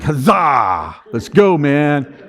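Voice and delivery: loud, hammed-up rallying shout; mock battle cry. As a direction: charge in like a cheering warrior. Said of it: "I wasn't like".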